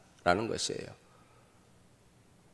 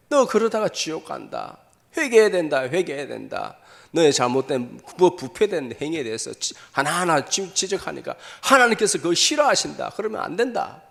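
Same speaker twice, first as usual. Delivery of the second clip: high, loud, urgent preacher's plea, acted; anguished warning; 4 semitones higher, 6 dB louder than usual. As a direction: plead with them as a distressed prophet. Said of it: "너무 안타까운 심령에".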